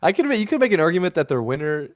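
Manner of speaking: ordinary talk, nothing marked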